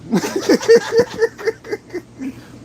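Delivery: big high cackle; loud high laugh. Real, not acted. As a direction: burst out laughing. Laughs at own joke.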